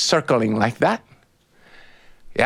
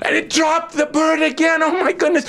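ordinary delivery, nothing marked

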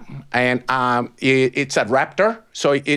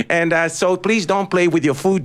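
plain reading voice

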